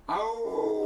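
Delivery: high, loud howl of a wolf pack. Acted. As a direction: throw your head back and howl. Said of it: "They howl".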